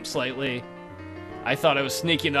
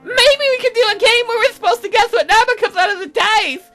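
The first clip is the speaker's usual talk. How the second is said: put-on very high, loud squeaky girl; excitable game girl; 6 dB louder than usual. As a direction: pitch it way up and push it out. Voices Aseline's game idea.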